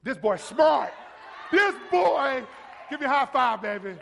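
loud, delighted shout of praise; proud preacher hollering approval; excited praise. Real, not acted. Preacher praising a boy's answer.

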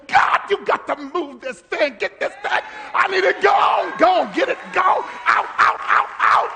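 loud, urgent commanding cry; desperate shouted prayer. Retold or imitated. Preacher praying under life's pressure, loud desperate shouting. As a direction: roar at God for help. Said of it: "life will hit you".

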